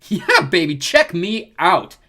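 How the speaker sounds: loud, high swaggering holler; cocky showboating. A narrator's reading. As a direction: show off to the crowd, stretching the words. Leo showing off to the nymphs.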